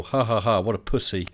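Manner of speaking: ordinary talk, nothing marked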